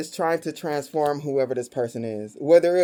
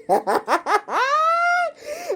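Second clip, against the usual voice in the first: staccato laugh climbing to a squeal; real high cackling laugh; helpless laughter. Real, not acted. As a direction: crack up, ending very high. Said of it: "laughing in tears".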